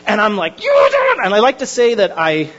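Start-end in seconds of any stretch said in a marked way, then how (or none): 0.6-1.2 very high-pitched mock yell; comic burst of road rage; retold angry outburst. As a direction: explode at the other driver, hammed-up. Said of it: "I am so angry".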